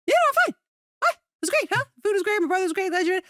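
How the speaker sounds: very high, chirpy mock answer; retold breezy reassurance. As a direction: brush off the worry in a high-pitched rush. Retold breezy answer to worried date.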